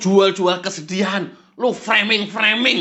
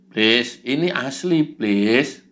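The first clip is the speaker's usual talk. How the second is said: lower, meek pleading voice; low follower voice; 8 semitones lower, much slower than usual. Acted. Follower pleads with the devil it's real. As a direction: beg the devil like a lackey.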